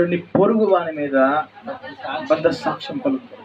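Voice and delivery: slow, mocking twist of the commandment; mock misquote. Preacher to congregation, slow mocking misquote. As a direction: drag it out as a sly wrong version. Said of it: "అందుకే అన్నాడు".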